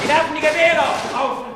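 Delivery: high, loud, fast commands; barked orders. Acted. Soldier barks orders at the townsmen. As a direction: bark like a drill sergeant.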